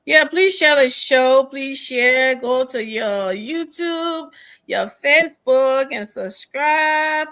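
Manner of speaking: loud, pushing plea to share and subscribe; rally the audience to share; eager appeal. Real, not acted. Urging viewers to share show.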